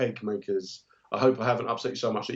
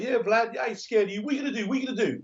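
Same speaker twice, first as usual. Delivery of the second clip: high mocking taunt at Vlad; mocking cocky critic; 10 semitones higher, faster than usual. A man taunting Putin in a high mocking voice. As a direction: taunt him from a safe distance. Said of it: "that man, when he was sitting there going".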